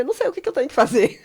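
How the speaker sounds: hurried, high-pitched exclamation; acted fluster. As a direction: blurt it out in mock helplessness. Acted fluster in retold exchange.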